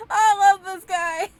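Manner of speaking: high, loud words through tears of laughter; gushing while cracking up; laughing so hard it's crying; laughing till crying. Real, not acted. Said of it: "I'm crying".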